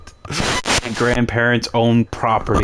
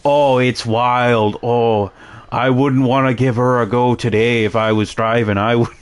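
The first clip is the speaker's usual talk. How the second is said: folksy hammed-up Canadian accent. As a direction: mimic a rural Canadian chatting about the weather. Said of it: "being stereotypes of Canadians".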